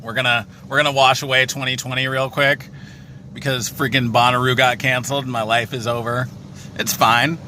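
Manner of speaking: over the roar of a car wash; talking inside a noisy car wash. Real, not acted. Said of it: "We're in the car wash right now".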